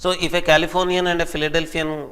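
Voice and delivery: normal talking voice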